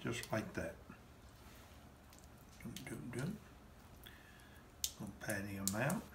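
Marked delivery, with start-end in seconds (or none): none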